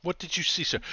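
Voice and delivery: a hint of a formal policeman; questioning policeman. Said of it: "the policeman is gonna enter the room".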